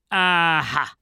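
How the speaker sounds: loud, high, drawn-out aha; calling out. A narrator's reading. Policeman discovers the drunken sailor, triumphant call. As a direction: exclaim it on catching a culprit. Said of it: "rief der Wachtmeister".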